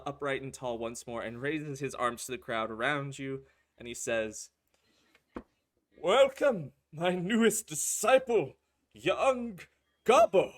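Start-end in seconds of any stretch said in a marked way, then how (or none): 6.0-10.5 booming high leader's welcome; grand welcome. Acted. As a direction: welcome the new disciple with grandeur. GM voices a cult leader welcoming.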